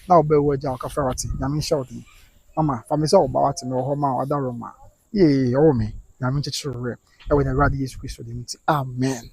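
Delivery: low, soft words of comfort; gentle condolence. Real, not acted. Host comforts a mother who lost her daughter, tender. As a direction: console a grieving mother softly and low.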